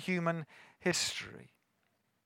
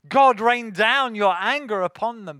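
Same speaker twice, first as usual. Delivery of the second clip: louder, higher call for vengeance; mock wrathful prayer; 5 semitones higher, 11 dB louder than usual. Preacher voices the prayer Jesus did not pray, loud mock wrath.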